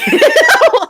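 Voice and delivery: laughing hard at her own joke; loud high laugh. Real, not acted.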